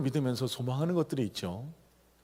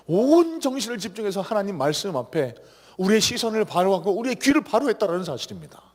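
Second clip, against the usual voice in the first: raised, full-force sermon exhortation; fervent preaching; 6 semitones higher, 5 dB louder than usual. Acted. Pastor rousing the congregation in worship, fervent preaching. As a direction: preach it higher and louder to the congregation.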